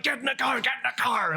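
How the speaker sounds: high, urgent mock cop command; imitating barked police order. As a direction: repeat the order like an alarmed cop. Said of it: "the guy's like".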